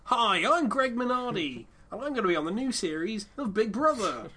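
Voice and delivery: lightly voiced reality TV star; hint of a reality show intro; reality tv confessional. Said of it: "Sounds like a reality T V star".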